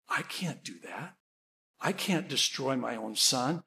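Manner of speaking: low, very soft protest; hushed refusal. Retold or imitated. Mother backing away from God's command, hushed refusal. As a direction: recoil in a near-murmur. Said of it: "she's shaking her head".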